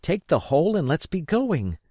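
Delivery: put-on boy urging his mother to flee; urgent boy. A narrator's reading. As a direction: press her to hurry, lightly voiced boy. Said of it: "said I".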